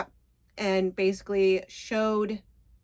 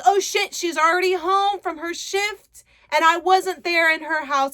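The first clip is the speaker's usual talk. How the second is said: panicked realization; 6 semitones higher, 5 dB louder than usual. Retold or imitated. Josh realizes he missed her.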